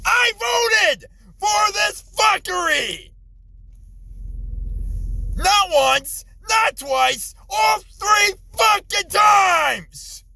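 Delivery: yelling, voice climbing high; furious shout. Real, not acted. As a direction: shout it out in disbelief. Ranting about voting three times.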